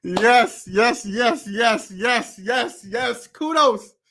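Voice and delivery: high, rapid-fire cheering yeses; excited approval. Real, not acted. Reviewer applauding the video's message, excited high cheering.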